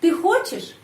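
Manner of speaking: хочешь jumps high on its first syllable; sharp questioning peak on хочешь; question intonation. Shown as a demonstration. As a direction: ask it by a high lift on хочешь. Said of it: "Coba, coba ikut saya".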